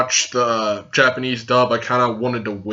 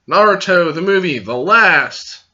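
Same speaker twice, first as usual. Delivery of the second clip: ordinary talk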